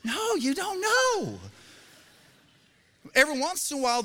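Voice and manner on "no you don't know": mock exasperated. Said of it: "We're like".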